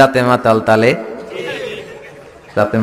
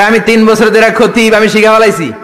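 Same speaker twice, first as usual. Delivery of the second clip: higher, louder proud friend; friend from Chandpur; 6 semitones higher, 5 dB louder than usual. Retold or imitated. Chandpur friend on the phone, boasting, louder and higher. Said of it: "আমার এক চাঁদপুরের বন্ধু".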